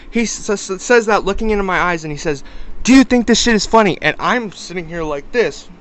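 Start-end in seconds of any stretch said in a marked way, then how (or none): 2.8-4.0 loud, higher, rapid-fire mock challenge; angry confrontation. Man getting in my face, angry challenge. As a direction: throw it in their face, fast and loud. Acted confrontation, repeated looking into eyes.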